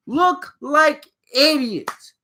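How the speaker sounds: drawn-out, slowed for emphasis; slow, scornful repeat of idiots; slow emphatic repeat. Real, not acted. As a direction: stretch each word out slowly.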